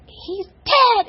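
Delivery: very loud, high-pitched cry; retold scream. Daughter re-enacting mother heard over the phone, screaming. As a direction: scream the news out as mother did. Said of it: "she just screamed it so loud".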